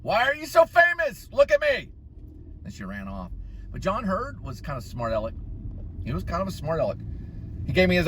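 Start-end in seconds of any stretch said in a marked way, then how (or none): 0.0-1.9 mock starstruck fan. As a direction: gush up high like a dazzled fan. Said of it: "talking to Julia Roberts".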